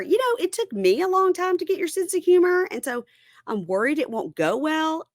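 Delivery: mock husband. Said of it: "he says to her".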